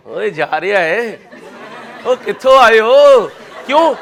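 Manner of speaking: loud mock catcall, rising sharply in pitch and volume; नकली फ़ब्ती. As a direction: call out teasingly, imitating a heckling crowd. लड़कियों की फ़ब्तियों की नकल. (छेड़ते हुए ऊँची आवाज़ में फ़ब्ती कसने की नकल)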